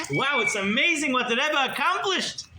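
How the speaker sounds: high-pitched crowd amazement; excited. Retold or imitated. Congregation marveling at the Rebbe's feat, excited. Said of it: "They're so excited".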